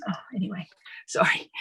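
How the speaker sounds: quick flustered whisper; whispered aside. Real, not acted. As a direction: whisper it to yourself, embarrassed. Flustered aside after lost name.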